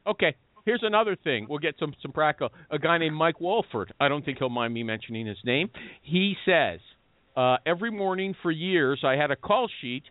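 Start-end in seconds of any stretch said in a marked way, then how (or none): none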